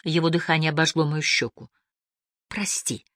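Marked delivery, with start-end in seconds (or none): 2.5-3.1 small, soft murmur; very quiet, subdued word; muttered apology. A narrator's reading. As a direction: murmur it meekly under your breath. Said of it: "пробормотала я".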